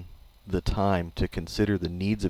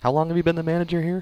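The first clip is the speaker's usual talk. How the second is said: mock interrogation; 8 semitones higher, faster than usual.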